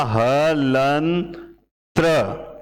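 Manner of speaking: हलंत्र stretched out very slowly, syllable by syllable; drawn-out demo. Shown as a demonstration. Teacher slowly spelling out हलंत्र for students. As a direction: draw out हलंत्र slowly so every sound is heard. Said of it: "सिर्फ समझाने के लिए लिख रहा हूं".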